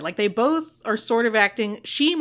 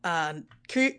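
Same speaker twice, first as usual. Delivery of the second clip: ordinary talk, nothing marked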